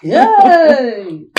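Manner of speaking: high, drawn-out cheer; long falling whoop of joy; excited cheer. Acted. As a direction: squeal with delight for a friend. Friend cheers at the news.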